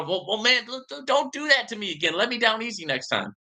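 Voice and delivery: high whiny plea; hammed-up hurt friend; mock whine. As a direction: beg him to go gentle. Friend pleads in joke.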